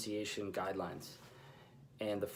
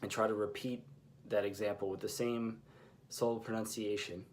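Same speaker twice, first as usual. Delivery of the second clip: ordinary talk, nothing marked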